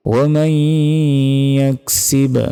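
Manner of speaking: slow, melodic tajweed chant; Quran recitation. Acted. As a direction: chant each word long and drawn out.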